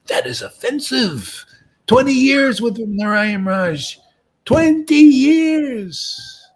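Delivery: high, indignant mock outburst; hammed-up outrage, slow loud stress on the years; offended disciple. Disciple scolding the preacher for eating, high and indignant.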